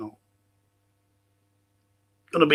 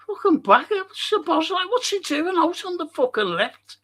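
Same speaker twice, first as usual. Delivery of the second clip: high, squeaky mocking imitation; mock a whiny fan in a falsetto; 14 semitones higher than usual.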